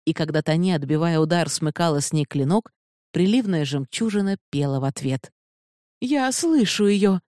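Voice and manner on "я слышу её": high, slow, drawn-out cry; narrated witch's exclamation, high and stretched; exhaled exclamation. A narrator's reading. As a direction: let it out slowly in a raised pitch. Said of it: "выдохнула Калайба".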